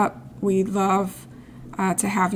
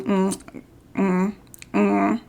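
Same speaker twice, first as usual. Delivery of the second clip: usual speaking voice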